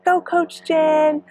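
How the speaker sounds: high, slow cheer from the crowd; calling out like a fan on the course; mock cheering fan. Spectator cheering her on, high and slow.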